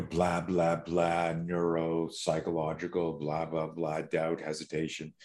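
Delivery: low, monotone string of filler; droning tangent. Acted. Speaker sends up his own rare tangents, droning. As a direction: rattle it off as tedious jargon.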